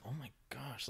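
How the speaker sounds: whispered disbelief; shocked whisper. Retold or imitated. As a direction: breathe it out in shock. Shocked aside about the lady.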